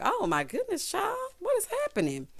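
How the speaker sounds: high-pitched, incredulous reaction; mock disbelief. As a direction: react aghast, voice jumping up. Host reacts to the scooting.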